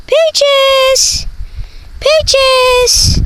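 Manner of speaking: very high sing-song dog call. Real, not acted. Calling the dog.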